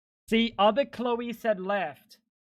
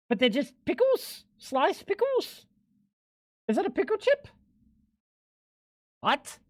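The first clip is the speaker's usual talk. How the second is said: high, incredulous questions; baffled disbelief; 8 semitones higher than usual. Real, not acted. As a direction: squeak out disbelief at pickles. Confused by pickle chips.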